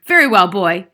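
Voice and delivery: loud, menacing address; overbearing headmistress; domineering Trunchbull. Acted. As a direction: loom over the boy.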